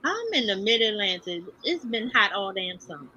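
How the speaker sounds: ordinary talk, nothing marked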